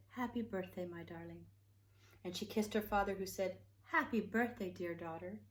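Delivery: lively, animated read-aloud voice with big swings; storybook reading. Acted. Reading Betty Bear's birthday story to children, animated storytelling. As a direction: read it like a bedtime picture book for little ones. Reads a picture book aloud.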